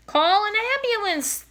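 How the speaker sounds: high, loud shriek; screaming lady. A narrator's reading. Lady still screams in crowd, high loud shriek. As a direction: keep screaming for help. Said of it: "the lady still was screaming".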